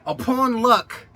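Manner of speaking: sudden high, loud, drawn-out cry; high exclamation. Acted.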